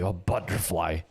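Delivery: low, put-on angry butterfly; playful show of anger; mock angry. Shown as a demonstration. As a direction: grumble butterfly with pretend anger. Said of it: "That sounds angry too".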